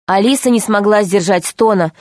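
usual speaking voice

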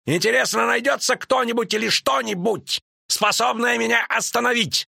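high, indignant call to arms; sustained high-pitched furious tirade; Рейнфарн shouting. A narrator's reading. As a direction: rage and challenge the whole hall. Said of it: "крикнул, вставая".

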